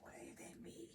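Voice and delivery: whispered disbelief at own voice; breathy whisper of surprise; shocked whisper. Retold or imitated. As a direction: hush it, stunned. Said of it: "I'm like".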